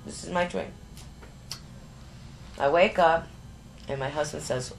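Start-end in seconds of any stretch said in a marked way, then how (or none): none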